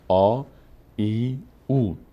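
آ، ای، او each stretched long and slow; long vowels drawn out. Shown as a demonstration. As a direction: hold every vowel آ، ای، او far out. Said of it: "اونا حرکات بلند هستن". (کشیده و آهسته: آ، ای، او)

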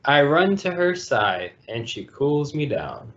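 plain reading voice

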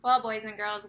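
usual speaking voice

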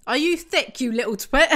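half-laughing outraged scold; mock outrage. Real, not acted. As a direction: tell the character off loudly. Insulting a character.

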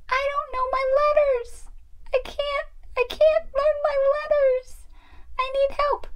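very high, whiny pretend sobbing; crying doll. Doll upset she can't learn her letters, mock-tearful. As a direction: wail it in a tiny toy voice. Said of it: "why are you crying?".

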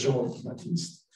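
Jean Baptiste as "zhahn ba-teest", softly; practised French Jean Baptiste, no P sounded; corrected French pronunciation. Shown as a demonstration. Guide showing what rangers practised, Jean Baptiste with silent P. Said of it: "we all made ourselves say it".

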